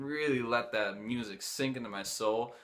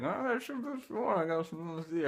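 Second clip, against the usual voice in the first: high hippie offer; 6 semitones higher than usual. Retold or imitated.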